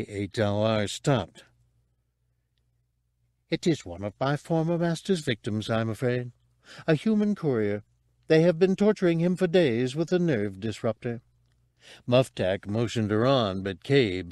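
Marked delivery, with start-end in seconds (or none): 3.5-11.2 higher-pitched, polite droid; regretful droid. Acted. Droid explains the tortured prisoner to Cabe, regretful and fussy. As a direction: report it like an apologetic droid servant.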